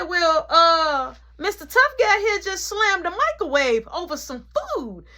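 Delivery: high, mocking tattling tone; mock him as a tough guy; sarcastic. Nana tattles to mom, high and mocking. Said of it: "she said".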